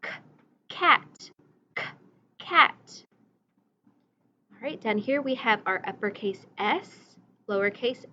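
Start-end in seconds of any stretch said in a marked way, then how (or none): 0.0-3.0 teacher modeling a hard "cat"; letter sound model. Shown as a demonstration. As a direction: pop the k in "cat" for the class. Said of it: "Sounds like K".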